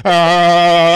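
loud breakdown cry; robot blowing a fuse; robot shutdown shout. Pretend.

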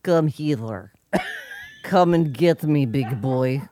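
hammed-up Russian temptress accent; lightly put-on, drawn-out Russian seduction. Co-host playing the seductive wife, mock Russian accent. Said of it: "in a Russian accent".

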